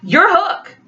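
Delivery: sharp rising accusation; accusing. A narrator's reading. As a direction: point the finger with the voice. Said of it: "pointed an accusing finger".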